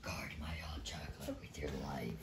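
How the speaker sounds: hushed whisper. Real, not acted. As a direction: whisper it close. Aside to partner, unvoiced.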